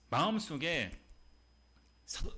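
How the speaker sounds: ordinary talk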